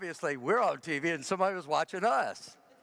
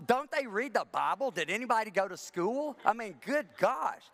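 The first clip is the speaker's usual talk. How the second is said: exasperated mock rant, higher; yelling at the TV; 7 semitones higher than usual. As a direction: scold the TV, fed up. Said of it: "I yell at the T V".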